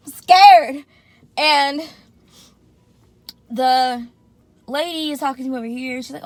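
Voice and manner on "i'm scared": high, loud declaration of fear; loud scared admission. Retold or imitated. Patient on the operating table, loudly owning up to fear. Same words repeated out loud.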